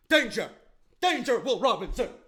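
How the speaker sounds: high, loud robot alarm; Lost in Space robot. Retold or imitated. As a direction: imitate a robot sounding the alarm. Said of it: "the robot from Lost in Space".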